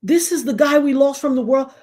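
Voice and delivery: mock bawling, pushed up and out; retold bawling. Father mourning [name] to his son, imitated bawling. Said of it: "The man was bawling".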